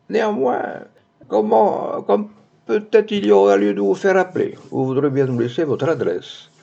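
put-on high official voice, easing down; officious commissioner. A narrator's reading. Commissioner asking the lady for her address, officious. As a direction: play a formal official, pitched well up.

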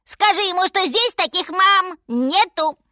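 high, loud monkey squeal. A narrator's reading. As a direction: yell it as an agitated little monkey. Monkey yelling to lion.

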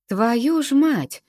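high, slow, drawn-out curse; stretched-out exclamation, pitched well up; shocked swearing. A narrator's reading. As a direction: swear in stunned amazement. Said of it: "выругалась рядом Влада".

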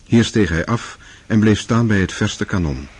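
ordinary talk, nothing marked

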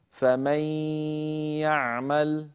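"فمن يعمل" with the noon merged into a long nasal ya; ghunnah merging. Shown as a demonstration. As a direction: draw out the nasal hum joining "فمن" to "يعمل". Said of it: "هنا أدغمنا النون في الياء".

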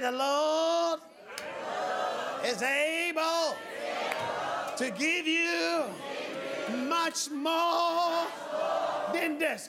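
slow, drawn-out proclamation; emphatic preaching refrain. Real, not acted. Preacher driving the verse home to the congregation, emphatic preaching. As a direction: declare each phrase and let it ring.

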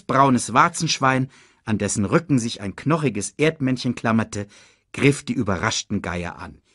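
ordinary delivery, nothing marked

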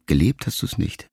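ordinary delivery, nothing marked

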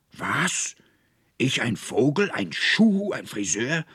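high, loud, incredulous outburst; enraged shout. A narrator's reading. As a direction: shout back in indignant fury, climbing high. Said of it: "schrie der Konrektor voller Zorn".

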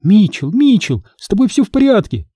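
worried mother, pitch climbing high; high-pitched motherly fussing; anxious lamenting. A narrator's reading. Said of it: "запричитала".

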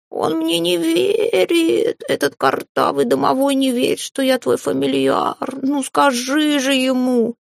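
high, slow, drawn-out complaint; wailing cat. A narrator's reading. Cat complains that the house spirit doubts him, high slow wail. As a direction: whine it high like a wronged cat. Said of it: "взревел Филя".

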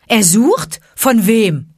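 loud, high, challenging retort; calling out. A narrator's reading. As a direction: fire the question back loudly. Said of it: "rief Mowgli". (laut und empört zurückgerufen)

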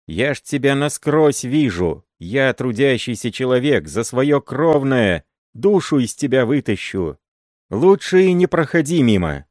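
high, loud angry tirade; furious shouting. A narrator's reading. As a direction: drive him away in a rage. Marina shouts at uncle Andrey.

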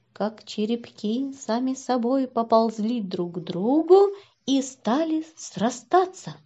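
ordinary delivery

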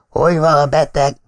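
lightly hoarse voice of a drinker; a country doctor rasping his question; hoarse drinker's voice. A narrator's reading. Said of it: "alkoholistákra jellemző reket hangján".

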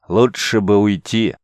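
slow, doubtful remark; skeptical. A narrator's reading. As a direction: say it like you doubt the whole plan. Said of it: "скептически заметил воин".

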